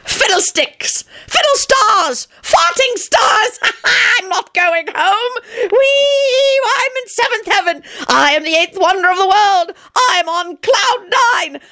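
very high, slow, giddy near-shrieking; giddy euphoria. A narrator's reading. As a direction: float away in mad delight. John delirious.

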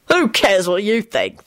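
slow, high sneer; nasty. A narrator's reading. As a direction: answer with drawn-out contempt. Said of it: "the fella responded nastily".

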